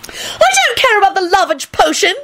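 very high tearful wail; high-pitched acted bawling. A narrator's reading. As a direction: wail it like a heartbroken girl. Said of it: "she wailed".

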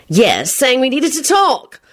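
slow, high, miffed reply; angry sniffiness. A narrator's reading. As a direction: answer huffily, drawing it out. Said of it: "responded with an angry sniff".

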